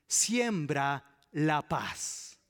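loud and slow; drawn-out forceful call; emphatic. Acted. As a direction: proclaim it, stretching each word. Pastor repeats key phrase.

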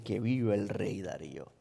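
low, soft, oily praise of the king; fawning flattery. Retold or imitated. Officials flattering the king, low oily praise. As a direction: lay it on thick for the king. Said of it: "le dicen".

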